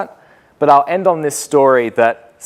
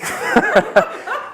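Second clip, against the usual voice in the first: breathy laugh; 9 semitones higher than usual. Real, not acted. Laughs at own story.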